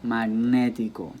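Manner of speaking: Magnetico said slowly with "ne" stressed; correct stress after wrong ones. Shown as a demonstration. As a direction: lean on the long "ne" in Magnetico. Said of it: "try to pronounce the words incorrectly".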